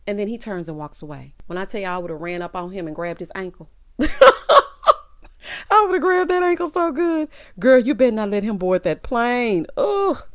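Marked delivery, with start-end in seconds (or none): 4.0-5.6 squealing burst of laughter; high shrieking laugh. Real, not acted. Viewer laughing at grabbing his ankle, shrieking. Laughs at her own joke. 5.7-7.3 much higher and louder, laughing; giddy. Real, not acted. Viewer picturing grabbing his ankle, giddy.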